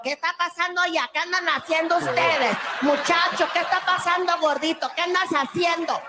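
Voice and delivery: fast, high Spanish chatter; panicked housekeeper. Retold or imitated. Housekeeper scolding the pranksters, fast and high. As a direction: rattle off alarmed questions. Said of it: "Housekeeping is freaking out".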